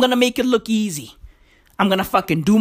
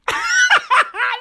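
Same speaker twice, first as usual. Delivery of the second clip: high delighted laugh. Real, not acted. Laughs at bird.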